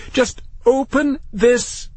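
very high, slow, frustrated yell; shouting. A narrator's reading. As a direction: shout it word by word. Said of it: "shouted Matthew".

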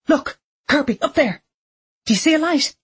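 high, urgent call to a friend; excited. Acted. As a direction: point it out, breathless and eager. Girl spots light in window.

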